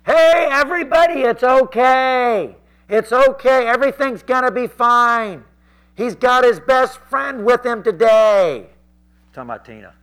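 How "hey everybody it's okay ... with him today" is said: high, drawn-out shout over a crowd; heckler yelling. Retold or imitated. Rob heckles from the crowd as preaching starts, yelling. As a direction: holler it slowly to everyone around. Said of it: "I hear somebody yelling".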